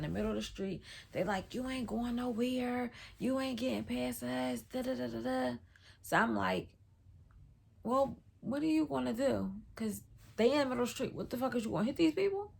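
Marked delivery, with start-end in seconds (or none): 1.5-5.6 higher, chanting mock confrontation; mimicked taunt. Girls blocking the street, retold. 7.8-12.5 higher, racing incredulous questions; retold alarm. Asking driver in panic, retold.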